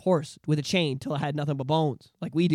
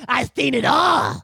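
high, slow shouted brag; show-off kid yelling, much higher; mock boastful shout; 7 semitones higher, 7 dB louder, slower than usual. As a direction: yell it like a cocky ten-year-old.

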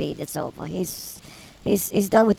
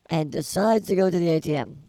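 ordinary talk

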